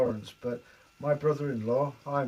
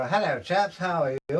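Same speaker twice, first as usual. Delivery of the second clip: higher, louder middle-class southern greeting; hammed-up southern middle-class accent; 4 semitones higher, 5 dB louder than usual. Shown as a demonstration. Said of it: "very middle class area".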